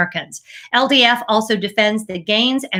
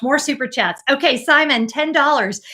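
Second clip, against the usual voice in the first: ordinary talk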